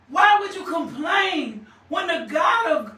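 normal talking voice

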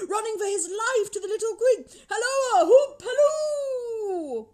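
very high, giddy excitement with bursts of laughter; ecstatic crying out. A narrator's reading. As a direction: call out in wild delight, building to whoops. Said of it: "cried Scrooge".

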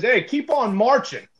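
slow taunting holler from upstairs; retold shout. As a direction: call it out the window, jeering. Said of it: "He shouts out the window".

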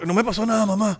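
high, loud mock kid; put-on childish protest. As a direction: insist to mom like a little kid. Plays child reassuring mother.

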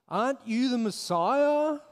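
high, sneering jeer; sarcastic. Acted. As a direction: drip sarcasm on it. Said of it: "His words dripping with sarcasm".

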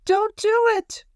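very high, slow little squeaky voice; pleading cry. A narrator's reading. Little paintbrush being pushed to the edge, crying out high. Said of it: "cried the little paintbrush".

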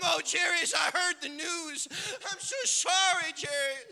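a touch of exaggerated pity; mock condolence. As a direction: play a mourner gushing sorrow. Acts mourners offering condolences.

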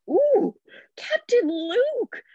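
high, slow, crazed cries; losing his marbles. Retold or imitated. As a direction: act unhinged and delighted. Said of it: "losing his marbles".

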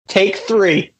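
ordinary delivery

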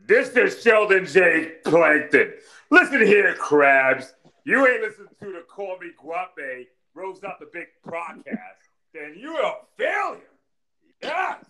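scheming little cartoon villain; Plankton voice. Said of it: "I can do Plankton".

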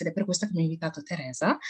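ordinary talk

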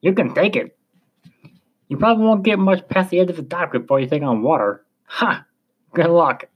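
put-on high, openly amused old sailor; mock him as a French old salt; French-accented captain having his fun. A narrator's reading. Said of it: "made no effort to conceal his amusement".

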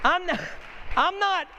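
high-pitched mock whine; mock protest. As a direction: protest in a squeaky, hammed-up voice. Mock complaint about endless schooling.

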